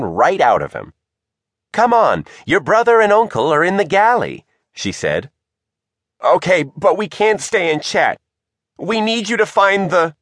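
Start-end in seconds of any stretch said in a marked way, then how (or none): 1.7-4.4 high-pitched, brisk invitation; hearty mother. A narrator's reading. Tilda leads Shivers to the galley, heartily. Tilda hurries her child along. 6.2-10.2 high-pitched pressing plea, cut off; urgent request. A narrator's reading. Margot presses the family for help, urgently.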